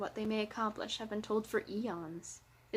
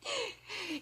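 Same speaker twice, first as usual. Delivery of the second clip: short high laugh; 12 semitones higher, 6 dB quieter than usual. Real, not acted. Narrator breaking off after an apology, quick soft laugh. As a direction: let out a small, quiet laugh.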